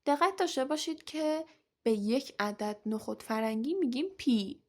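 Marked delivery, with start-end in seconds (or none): 4.2-4.6 پی ending on an open long ee; teacher's demo of پی without the plural ending; singular pea. Shown as a demonstration. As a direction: say پی as pee, with no S sound at the end. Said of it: "بدون اس جمع پی".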